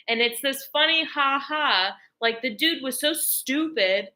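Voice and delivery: ordinary delivery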